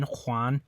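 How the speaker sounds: "Juan" with a Spanish H-like J, hwan. Shown as a demonstration. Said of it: "names like Juan".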